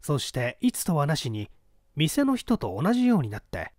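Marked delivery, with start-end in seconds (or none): none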